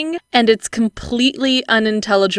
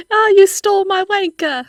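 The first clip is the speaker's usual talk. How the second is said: mock posh voice; 11 semitones higher than usual.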